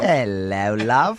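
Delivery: low, hammed-up British flirt; mock British accent. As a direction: play the flirting Englishman. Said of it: "very British, I think".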